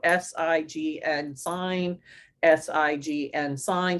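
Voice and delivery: slow, evenly spaced letters, then the word; letter-by-letter spelling. Shown as a demonstration. Teacher showing the tapping routine, slow letter-by-letter spelling. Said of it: "as they say the letter".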